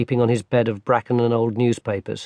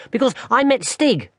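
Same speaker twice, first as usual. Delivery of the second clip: put-on a young boy explaining; small boy; 10 semitones higher than usual. Barney tells his grandmother about his fall, high little-boy voice.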